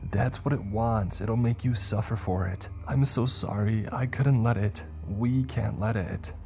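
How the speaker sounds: high, desperate tearful pleading; intensified sobbing. A narrator's reading. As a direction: beg and apologise as if sobbing. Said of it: "Milo's sobbing intensifies".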